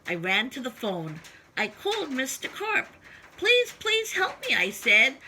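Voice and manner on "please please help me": high, begging plea; pleading. Acted. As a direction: beg him for help. Boy begs Mister Carp on phone.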